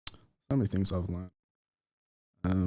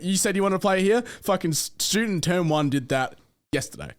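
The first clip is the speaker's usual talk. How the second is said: raised pitch, insistent retold pitch; conviction; 7 semitones higher than usual. Salesman challenging a prospect with student results, with conviction. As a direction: re-enact a salesman sure of his school. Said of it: "hear the conviction in my voice".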